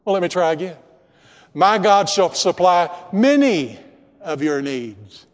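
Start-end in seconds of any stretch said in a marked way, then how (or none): 1.5-5.2 testing misquote; deliberately wrong quote. Shown as a demonstration. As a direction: stress on many, falling. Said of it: "Is that what your Bible says?".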